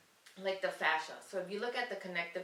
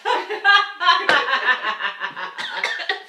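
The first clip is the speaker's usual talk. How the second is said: big hearty demo laugh, louder; loud forced laugh; 8 semitones higher, 17 dB louder than usual. Shown as a demonstration. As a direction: belly laugh on cue. Demonstrates laughing game.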